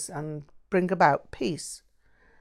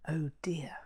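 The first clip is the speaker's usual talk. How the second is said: soft, hushed aside; whispered dismay; 11 dB quieter than usual. A narrator's reading. Lydia to a friend after the nun walks away, whispered. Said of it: "Lydia whispered".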